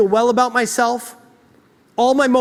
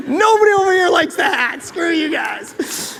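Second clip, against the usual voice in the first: laughing banter; 6 semitones higher, slower than usual. Real, not acted. Joking with audience, laughing.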